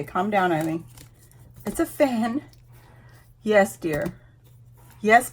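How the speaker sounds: ordinary talk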